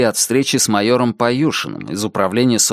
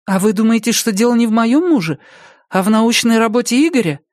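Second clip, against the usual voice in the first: higher voice with a touch of worry; mild anxiety; 11 semitones higher than usual. A narrator's reading. Ex-wife asks police about Igor's research, mildly anxious. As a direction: ask it a little anxiously, pitched up. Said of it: "С тревогой спросила Наталья".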